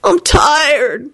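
high-pitched whiny moan. A narrator's reading. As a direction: moan like a worn-out whiner. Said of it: "moaned Kenny".

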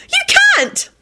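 very high delighted squeal; happy squeal. A narrator's reading. Daughter amazed at dad's money, happy squeal. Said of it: "Rosie squealed happily".

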